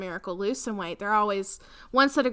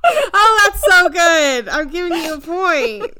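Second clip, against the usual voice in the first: delighted laughing outburst; 8 semitones higher, 14 dB louder than usual. Real, not acted. Delighted by friend's answer.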